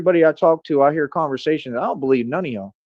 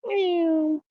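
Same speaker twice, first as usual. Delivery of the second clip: very high, soft put-on kitty meow; cat meow; 5 dB quieter than usual. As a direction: meow like a tiny happy cat. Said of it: "It's such a happy kitty".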